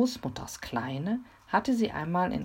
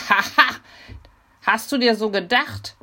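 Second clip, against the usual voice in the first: louder, mocking triumphant laugh and taunt; satisfied gloating; 6 semitones higher, 11 dB louder than usual. A narrator's reading. Mimi taunts the robber through the door, gloating loudly. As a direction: gloat at the foiled intruder. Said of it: "sagte Mimi zufrieden".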